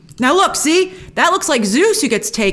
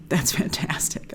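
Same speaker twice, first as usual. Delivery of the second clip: hushed awe; 8 semitones lower, 10 dB quieter than usual. Real, not acted. Reacting to gross tiger.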